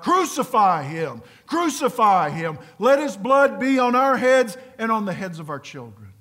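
loud mob cry; the crowd calling for the crucifixion, loud. Acted. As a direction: shout it like a hostile crowd demanding death. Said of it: "the refused kiss cries out".

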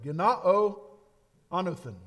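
"Genao" as gi-NAH-oh, "anothen" as AH-no-theh; Greek words. Shown as a demonstration. Preacher teaching the congregation the Greek, careful pronunciation. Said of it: "Genao is the Greek word".

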